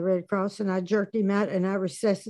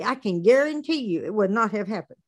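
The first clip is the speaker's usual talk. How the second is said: ordinary talk, nothing marked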